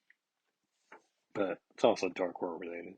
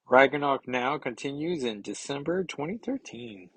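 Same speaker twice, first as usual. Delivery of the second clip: ordinary delivery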